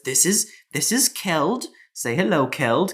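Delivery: put-on older female dwarf. Dwarf mother introducing her son to guests, lightly prompting. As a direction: present your child and prompt him. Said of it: "She says".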